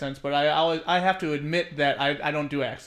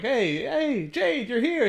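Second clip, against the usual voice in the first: high-pitched, playful hail; acted greeting; 7 semitones higher, much slower than usual. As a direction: greet a regular with mock delight. Acting out someone's greeting.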